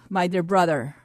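ordinary talk

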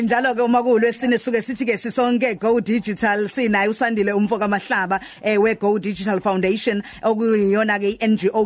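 ordinary talk

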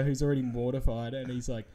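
usual speaking voice

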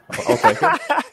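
laughing through the words; high, giggly acceptance. Real, not acted. Laughs at new nickname.

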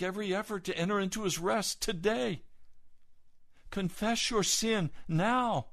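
urgent command. Acted. Urgent plea to congregation.

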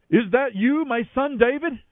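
high, loud call across the distance. A narrator's reading. Saul calling up to David on the hill, high and loud. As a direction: reach out to him with the question. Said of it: "Saul recognized David's voice and called out".

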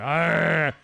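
loud, drawn-out "arr"; mock animal-like snarl; imitated growl. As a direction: growl it at a rival. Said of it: "growled at my homeless man".

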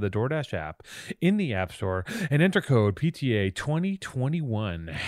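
upbeat podcast sponsor read. Acted. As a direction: read it like a radio ad. DoorDash ad read.

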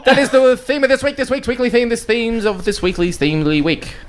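high, hammy announcer voice; excited announcer. Acted. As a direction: announce the theme with mock grandeur. Playful tongue-twister announcement.

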